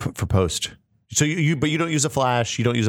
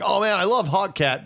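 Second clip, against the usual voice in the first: higher-pitched pretend fan enthusiasm; mock gushing; 6 semitones higher than usual. Passer-by pretends to know the character, mock gushing. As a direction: gush like someone faking recognition. Imagined fan feigning recognition.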